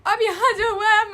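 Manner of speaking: loud, slow, laughing as the story starts; can't hold back the laugh while telling it. Real, not acted. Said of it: "मुझे इतना हंसी आ रहा है बताते हुए".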